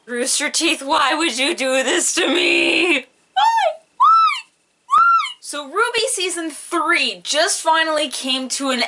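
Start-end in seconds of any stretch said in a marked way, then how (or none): 0.1-5.1 high, loud despairing why; anguished cry. Real, not acted. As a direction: wail it at the sky. Fan despair at Rooster Teeth.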